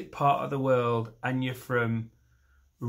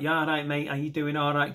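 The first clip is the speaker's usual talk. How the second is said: nasal Mancunian greeting on one note; monotone Mancunian; 2 semitones higher than usual. Shown as a demonstration. Comic showing how Manchester greets a mate, monotone Mancunian. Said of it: "pick one note and keep hitting it".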